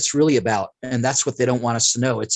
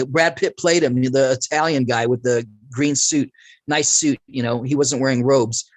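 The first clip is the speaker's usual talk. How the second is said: ordinary talk, nothing marked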